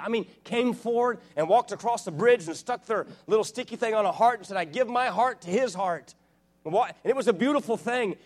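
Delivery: high and loud, jubilant sermon build; full-force preaching. Acted. Recounting eight hundred people coming to Christ, soaring preaching. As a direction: swell with joy as you tell it.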